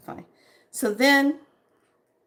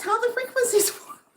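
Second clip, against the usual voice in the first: laughing; 14 semitones higher than usual. Real, not acted.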